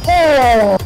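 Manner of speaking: high falling cry; real startled holler; loud exclaimed oh. Real, not acted. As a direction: let out a loud, sliding oh. Reacting to game hit.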